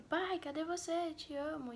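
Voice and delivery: high, slow call across a mall, imitating herself; calling out loud. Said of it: "às vezes eu gritava no meio do shopping". (chamando o pai alto, imitando o próprio grito)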